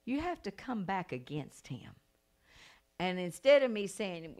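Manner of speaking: normal talking voice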